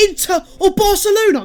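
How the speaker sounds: incredulous. Acted. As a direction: echo the claim as if it's absurd. Mocking disbelief at Mustafi's hopes.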